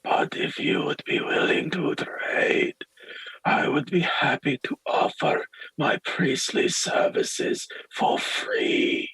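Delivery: slow, breath-only whispered offer; whispering priest, hopeful. Acted. Priest offers the peddler a trade of services, slow whisper. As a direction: whisper the offer slowly, humbly. Game master plays the priest.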